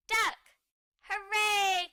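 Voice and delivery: high, loud triumphant shout; excited cry. Acted. Everyone crying out the right guess, excited. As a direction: call it out in triumph. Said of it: "cries everyone".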